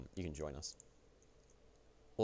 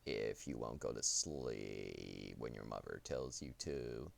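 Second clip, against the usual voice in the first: deep, slow Grim Reaper voice; drawn-out ominous reaper menace; Reaper impression; 8 semitones lower, 7 dB quieter, slower than usual. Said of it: "a Reaper impression".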